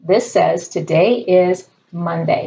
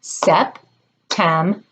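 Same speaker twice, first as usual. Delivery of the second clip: September said slowly, each syllable separate; Sep, tem, ber, one clap per beat; clapped syllables; much slower than usual. Shown as a demonstration. Teacher leading a clapping game, slow syllables. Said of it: "clap your hands for each part of that word".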